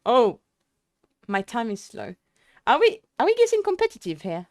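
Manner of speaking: ordinary delivery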